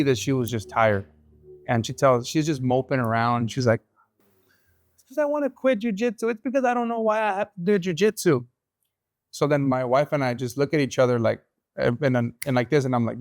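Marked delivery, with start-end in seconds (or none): 4.9-8.4 put-on much higher whiny little girl voice; child voice. Daughter moping to dad about quitting, high whiny kid voice. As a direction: mope it out like a tired six-year-old.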